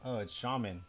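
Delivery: ordinary delivery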